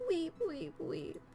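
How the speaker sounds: mock sobbing chant, falling away to soft; fake weeping. Mourners faking grief over the cat, mock weep.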